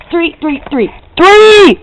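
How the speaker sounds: building to a loud cry of three; emphatic count. Real, not acted. Kid counting her babysitting shifts, building to a shout.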